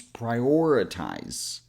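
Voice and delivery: PRIORITIZE slowly, weight on OR, schwa after it; stress on OR. Shown as a demonstration. Teacher putting it all together, slow "prioritize" stressed on OR. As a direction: say "prioritize" as pry-OR-uh-tize with a Z ending. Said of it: "Put some emphasis here".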